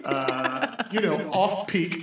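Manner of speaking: giggling through a pitch; laughing sales pitch. Acted. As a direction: sell it while cracking up.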